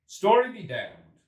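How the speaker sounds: loud, slow, high brush-off; the hungry time traveller snapping back; impatient curse. A narrator's reading. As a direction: wave the story away in exasperation. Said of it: "said the time traveller".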